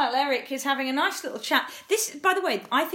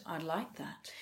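much lower and far softer smooth-talker; 7 semitones lower, 13 dB quieter, much slower than usual. Retold or imitated. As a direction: purr it back like a charmer, low and hushed. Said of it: "smooth voice".

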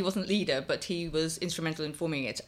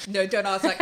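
normal talking voice

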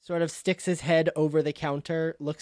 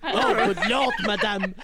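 put-on loud, high French-accented dismay; appalled; 6 semitones higher, 3 dB louder than usual. Cheese seller seeing the woman's face, appalled. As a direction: recoil loudly, with a laugh creeping in.

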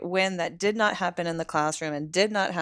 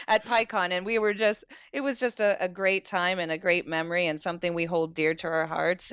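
normal talking voice

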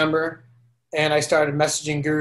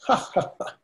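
breathy knowing guru chuckle; soft mock guru laugh; 4 semitones higher, 5 dB quieter, faster than usual. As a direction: laugh like the teacher who already knows. Guru laughs in retold story.

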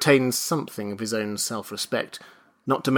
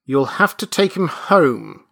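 raised, louder firm order; insistent; 4 semitones higher, 6 dB louder than usual. A narrator's reading. Nurse orders the father, insistent. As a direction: insist firmly. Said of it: "insisted the nurse".

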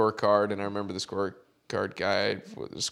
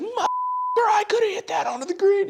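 raised-voice mock rant; retold screaming; 3 dB louder than usual. Caddy chewing out his player on the course, retold yell. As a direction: reenact screaming at someone in anger. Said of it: "I was screaming at".

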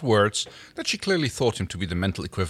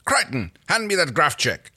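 raised, brisk demand; 7 semitones higher than usual. Acted. Erin wants the tool handed over, demanding. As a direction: order it, pitched up. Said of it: "Erin demanded".

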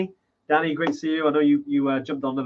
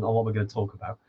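usual speaking voice

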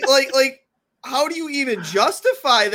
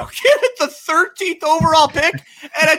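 rising, louder disbelief; excited; 10 semitones higher, 3 dB louder than usual. Real, not acted. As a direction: builds to an excited shout. Excited about draft pick haul.

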